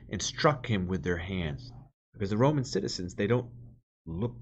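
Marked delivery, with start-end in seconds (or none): 0.1-1.7 louder, measured reading of the Gospel; scripture reading. Acted. Preacher reads the Passion to the congregation, solemn proclamation.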